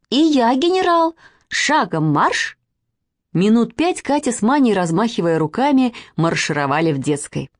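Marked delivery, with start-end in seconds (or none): 0.1-2.6 high boast, then drawn-out order; child playing general. A narrator's reading. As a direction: pipe up as a little commander. Second girl playing general. (по-детски командуя, с растяжкой)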